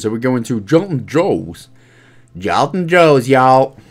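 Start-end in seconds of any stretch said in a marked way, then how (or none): 2.4-3.8 mock American twang; American accent. Gamer trying out an American accent on a place name, mock American accent. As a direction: put on a playful American accent. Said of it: "did I do a good American accent".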